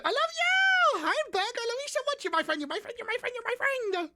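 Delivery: very high, cutesy gush; squeaky love for chat; gushing squeal. Acted. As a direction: coo at friends in a squeaky voice.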